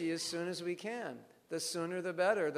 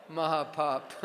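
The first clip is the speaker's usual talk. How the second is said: laughing through the joke; slower than usual. Real, not acted.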